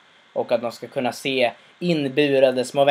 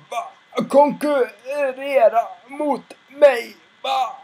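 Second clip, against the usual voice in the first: much higher, slow incredulous question; shocked father; 10 semitones higher, much slower than usual. Retold or imitated. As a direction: stretch out the disbelief. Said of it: "han blir helt chockad".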